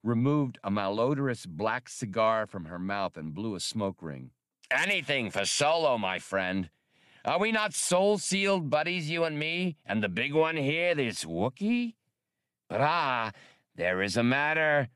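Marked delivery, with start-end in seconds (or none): 4.7-14.9 high, oily trader voice; smooth-talking alien hustler; lightly voiced green primate trader; alien wheeler-dealer. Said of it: "Fadoop, scratching behind one ear".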